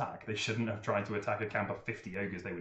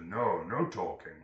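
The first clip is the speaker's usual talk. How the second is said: stern ogre command; 3 semitones lower than usual. Acted. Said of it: "and he says".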